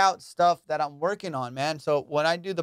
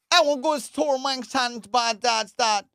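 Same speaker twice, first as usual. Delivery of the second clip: much higher, louder Jamaican-accented English; 9 semitones higher, 4 dB louder than usual. Shown as a demonstration. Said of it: "they just slow it down when they're speaking English".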